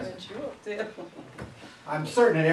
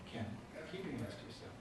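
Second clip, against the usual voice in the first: quiet instruction; 16 dB quieter, faster than usual. Shown as a demonstration. Teacher quietly telling Kenneth to stop touching, soft. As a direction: keep it calm and quiet. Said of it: "Instead of".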